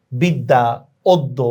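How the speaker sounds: বিদ্যা, অদ্য as "bidda, oddo"; doubled consonant. Shown as a demonstration. Teacher illustrates doubling, বিদ্যা and অদ্য with double d. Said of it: "তাহলে দ্বিত্ব হয়".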